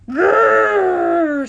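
loud, high drawn-out growl; bear growl. A narrator's reading. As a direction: growl long like a cub bear. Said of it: "said the brother bear".

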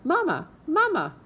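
put-on high, squeaky baby-bird chirp; peeping gosling. A narrator's reading. Newborn gosling calling the robot mama, high peeping voice. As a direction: peep it out like a tiny hatchling. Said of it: "peeped the gosling".

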